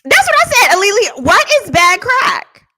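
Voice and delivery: high, loud cry of disbelief; exasperated outburst. Acted. Baffled by talk of bad crack, exasperated outburst. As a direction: belt it out high, dragging the final question.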